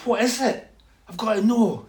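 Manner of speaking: high, urgent question; desperate to be let in on it; eager curiosity. Retold or imitated. Retold man desperate to hear.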